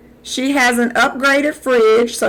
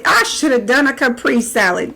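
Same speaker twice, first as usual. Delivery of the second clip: ordinary delivery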